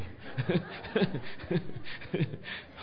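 long, breathy laugh; real wheezy chuckle at your own story. Real, not acted. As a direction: laugh helplessly for a few seconds. Laughs at own retold refusal.

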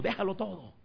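high mock yell without much volume; retold shout.

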